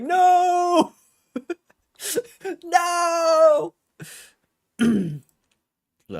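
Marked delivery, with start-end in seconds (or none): 0.0-3.7 shrill high laughing cry of no; mock anguished wail. Streamer replaying his panic when the packs sold out, mock wailing. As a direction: wail in hammed-up despair. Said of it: "and I was like".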